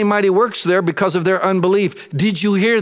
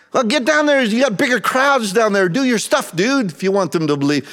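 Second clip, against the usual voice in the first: mocking brothers; 5 semitones higher than usual. Brothers taunting Jesus to go to the feast, mocking jeer. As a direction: taunt him like a scornful brother. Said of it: "They're mocking him".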